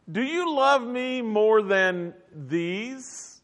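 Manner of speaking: very slow, each word drawn out; slow earnest question. Retold or imitated. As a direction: plead it slowly, weighing every word. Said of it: "Jesus said this".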